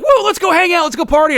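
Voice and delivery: high, loud, excited urging; hammed-up college party enthusiasm; mock party eagerness. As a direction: whoop and rally friends to go out. Imitates own college party eagerness.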